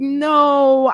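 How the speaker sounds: exclaimed objection, quoting herself; emphatic refusal. Retold or imitated. Quoting her firm objection.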